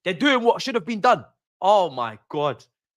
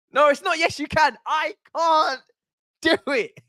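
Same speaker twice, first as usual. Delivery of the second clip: Sam Thompson meme; 7 semitones higher, 4 dB louder than usual. Retold or imitated. Fan acts out the celebrity meme, high mock crying. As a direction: beg to be let off, half sobbing. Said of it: "That's the meme".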